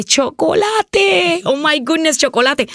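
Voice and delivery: excited gasp. Retold or imitated. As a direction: stretch out chocolate like a thrilled kid.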